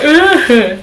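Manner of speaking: a high, sudden imitated sob; mock sob gasp. Showing viewers how she was not crying, a high mock sob. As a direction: fake one loud gulping sob sound. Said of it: "but not like".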